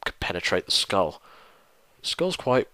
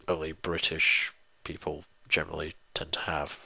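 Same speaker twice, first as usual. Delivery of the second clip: whisper; 4 semitones lower, 9 dB quieter than usual. Real, not acted. Confiding aside about British food.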